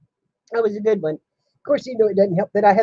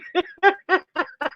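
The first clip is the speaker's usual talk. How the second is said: high, real giggling fit; embarrassed laughter; 11 semitones higher, 4 dB quieter than usual. Real, not acted. Woman teased by Kathy, embarrassed laughter. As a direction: giggle helplessly, flustered. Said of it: "Now you've embarrassed me".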